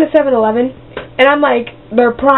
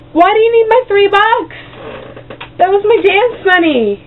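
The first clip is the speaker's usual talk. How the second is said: mock outrage; 9 semitones higher than usual. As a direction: protest like you were robbed. Outraged complaint.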